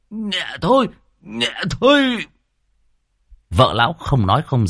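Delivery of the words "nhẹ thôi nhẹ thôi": much higher, whimpering plea; pleading in pain. A narrator's reading. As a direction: beg her to ease up. Husband's ear pinched by wife. (giọng cao van xin vì đau)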